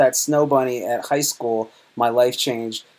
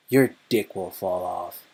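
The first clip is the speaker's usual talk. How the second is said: dad's grave warning; 6 semitones lower, 7 dB quieter, slower than usual. Retold or imitated. Dad's stern warning to son.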